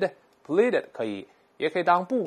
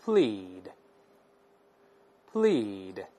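slow, clear word-by-word reading for repetition; slow word drill; 3 semitones lower, much slower than usual. Shown as a demonstration. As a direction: say each word twice, slowly, for the class to repeat. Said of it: "Now read after me". (慢速领读单词)

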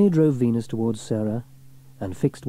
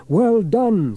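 loud, higher relieved praise; 11 semitones higher, 8 dB louder than usual. A narrator's reading. Sam praises Sarah after the catch, relieved. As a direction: let the worry go. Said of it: "said Fireman Sam with relief".

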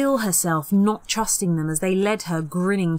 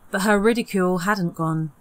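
ordinary delivery

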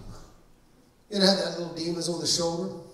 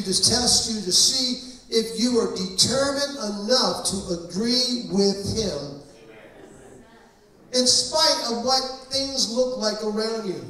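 slow, louder preacher's warning; preaching; 5 semitones higher, 4 dB louder than usual. Acted. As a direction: lean into every word from the pulpit. Preaching application, slow and loud.

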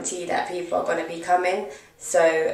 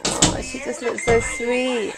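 cooing; 6 semitones higher, slower than usual. Real, not acted. Cooing over a baby girl.

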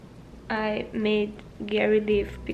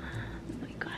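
breathy exasperated whisper; 15 dB quieter than usual. Real, not acted. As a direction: whisper it to yourself, worn out. Overwhelmed aside after pregnancy talk.

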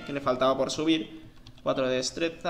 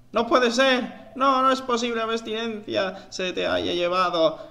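anguished hero; 7 semitones higher, 4 dB louder, slower than usual. Acted. Hero mourns Catalina, dramatic reading.

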